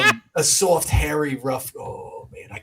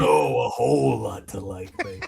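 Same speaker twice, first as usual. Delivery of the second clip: low, flirty put-on voice; 6 semitones lower than usual. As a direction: purr it suggestively.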